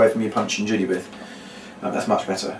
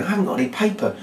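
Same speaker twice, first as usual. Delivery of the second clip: softer, higher acted dismay; earnest little disappointment; sincere helplessness; 7 semitones higher, 5 dB quieter, faster than usual. Shown as a demonstration. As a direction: plead genuine lack, quietly. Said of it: "real kind of sincerity about not being able".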